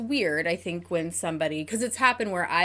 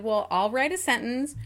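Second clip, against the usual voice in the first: normal talking voice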